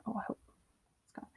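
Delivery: soft unvoiced whisper to herself; whispered aside. Real, not acted. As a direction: whisper it under your breath. Aside while fixing the screen.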